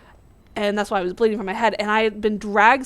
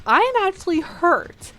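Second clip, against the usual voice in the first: higher-pitched retold shock; mock-shocked, pitched well up; sudden shock; 8 semitones higher than usual. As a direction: act out a sudden jolt of realization. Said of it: "the sudden shock of".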